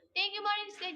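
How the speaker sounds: bright, loud shout-out of thanks; loud thank-you. Real, not acted. Streamer loudly thanking a gifter, loud shout. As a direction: call the thanks out high and loud.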